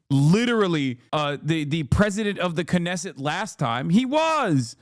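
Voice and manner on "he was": ordinary talk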